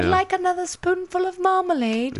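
high, put-on British child voice; British orphan child. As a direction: beg politely as a mock Oliver Twist urchin. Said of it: "all your British children are Oliver Twist".

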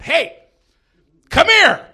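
loud, high summons across a bar; yelling. Retold or imitated. Comic retells yelling at the guy to come over, loud high shout. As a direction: call him over at full volume. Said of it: "the guy that I just yelled at".

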